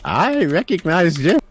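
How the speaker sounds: put-on high, slow, loud old man voice; doddering old magister, proud and high-pitched; old professor, pleased. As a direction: insist slowly in a high elderly voice.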